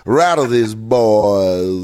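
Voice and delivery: very slow, stretched words; drawn-out taunt. Acted. Urging her to unsettle the rival players, slow drawl. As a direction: stretch out RATTLE and BOYS.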